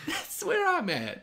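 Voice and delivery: slow, very high squeal with a laugh; mock-aggressive defiance. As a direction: drag it out squeakily, half laughing. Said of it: "Why, why so aggressive?".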